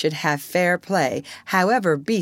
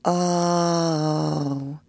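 moaning; 3 semitones higher than usual. Acted. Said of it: "they all moaned".

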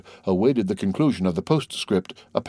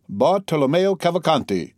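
higher-pitched, pleased echo of his own name; joyful reply; 8 semitones higher than usual. A narrator's reading. Italian confirms his identity to the Count, joyful. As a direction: repeat the name with lifted delight. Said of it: "joyfully replied the Italian".

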